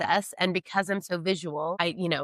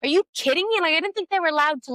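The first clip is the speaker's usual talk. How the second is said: high, louder disbelief; are you kidding me, pitched way up; incredulous outburst; 10 semitones higher, 4 dB louder, faster than usual. Retold or imitated. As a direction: shriek of disbelief at the news. Outraged retold reaction.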